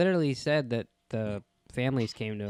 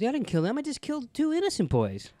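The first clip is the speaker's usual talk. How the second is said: high mock voice; 13 semitones higher than usual. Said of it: "He goes".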